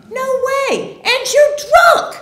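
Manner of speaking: very high, loud scolding; hammed-up argument, voice shooting way up; indignant refusal. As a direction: squawk an outraged accusation at your father. Retold quarrel with drunk father.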